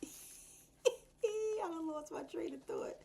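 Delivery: high, slow line through laughter; amused at losing the thread; laughing. Real, not acted. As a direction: laugh at yourself while you say it. Laughing at herself.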